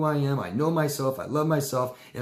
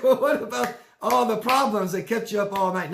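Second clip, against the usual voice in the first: higher, louder pointed question; mock challenge; 11 semitones higher, 5 dB louder than usual. Someone challenging the hero, high and mocking. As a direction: needle them with a mock voice. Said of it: "It's like".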